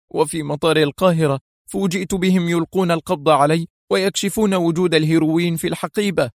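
high-pitched, distressed account; agitated. A narrator's reading. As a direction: recount it with pent-up agitation. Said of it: "وهو يرتجف من الانفعال". (سرد منفعل بنبرة مرتفعة)

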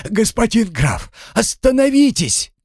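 high, urgent call to stop; shouting plea. A narrator's reading. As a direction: yell after the riders. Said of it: "закричал он".

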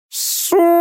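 very high-pitched startled screech; shrieky owl outburst; yelling owl. A narrator's reading. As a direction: screech the curse like a startled bird. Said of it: "заорала подпрыгнувшая сова".

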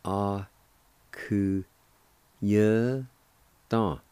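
A ku ye Don in separate beats; syllable by syllable. Shown as a demonstration. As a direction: tap out A ku ye Don one syllable at a time. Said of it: "pronounced like this".

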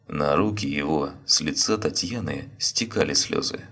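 ordinary delivery, nothing marked

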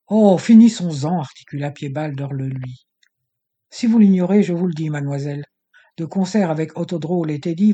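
villain exposing her father's crimes; harsh, cutting. Acted. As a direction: lash her with every sentence. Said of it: "d'une voix âpre, cinglante".

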